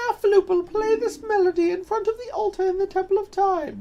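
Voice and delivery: high princess voice. Acted. Voicing Zelda game text.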